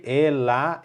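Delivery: ordinary talk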